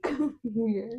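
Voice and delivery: tender retold invitation; gentle coaxing mom. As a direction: act out coaxing a sorry child closer. Coaxing the same child gently.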